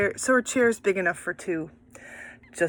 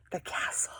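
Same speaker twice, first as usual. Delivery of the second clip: whispered the castle; 13 dB quieter than usual. Shown as a demonstration. Whispered correction.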